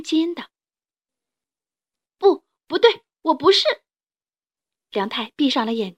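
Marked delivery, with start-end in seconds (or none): 2.2-3.6 high, loud distressed denial; desperate child. Acted. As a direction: cry out in panic.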